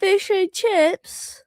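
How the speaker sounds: put-on child's careful British accent. Shown as a demonstration. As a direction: say it like a proper Brit, drawn out. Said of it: "Can you say it with a British accent".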